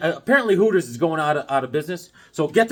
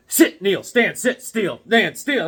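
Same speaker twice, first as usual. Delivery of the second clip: high, loud drill-style orders; mock rapid commands; 5 semitones higher, 5 dB louder, slower than usual. Churchgoer mimicking the cardio of Mass, high loud commands. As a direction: fire off each order as a punchy single word.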